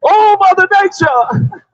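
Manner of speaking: high, drawn-out mock cry of panic; mock scream. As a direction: wail it like someone caught in a disaster. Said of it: "What they be screaming?".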